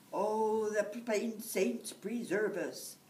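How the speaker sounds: whining. Acted. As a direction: moan as if all the saints should pity you. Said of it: "said Jamie would whine".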